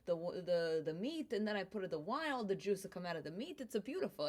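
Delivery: hammed-up Italian grandpa describing his sauce; Italian immigrant accent, fond and proud; Vito explaining. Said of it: "He's like".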